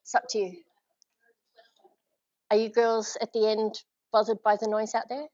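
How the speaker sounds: ordinary delivery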